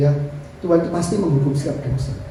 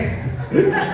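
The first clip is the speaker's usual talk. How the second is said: breathy laugh; 10 semitones higher than usual. Real, not acted. Laugh at own joke.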